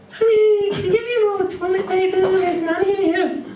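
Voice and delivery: put-on high, calling girlfriend; mock high girlfriend call. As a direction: squeak a call from the toilet.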